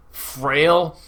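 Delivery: usual speaking voice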